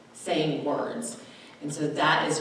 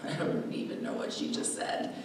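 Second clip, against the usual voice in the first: confused aside; 9 dB quieter than usual. Retold or imitated. Confused listener's inner reaction.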